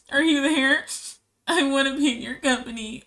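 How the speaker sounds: needy cat voice. Retold or imitated. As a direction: play the clingy cat begging for attention. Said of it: "now she's like".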